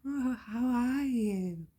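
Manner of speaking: high, slow, sugary fake greeting; a sing-song, insincere how are you; fake cheerful greeting. Mocking be-kind people with a fake high cheerful greeting. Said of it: "you're saying to people".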